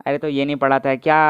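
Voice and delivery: ordinary delivery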